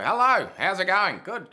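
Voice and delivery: put-on high, loud old Aussie grandad greeting; hammed-up cheerful old bloke; imitating grandpa. Actor imitates his grandpa's greeting for interviewers. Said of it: "my grandpa, um, he used to".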